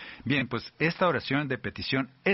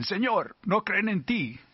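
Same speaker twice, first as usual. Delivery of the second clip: higher, eager apostles pressing their Lord; urging apostles; 8 semitones higher than usual. Acted. Apostles urging Jesus to act against unbelievers, eager and higher.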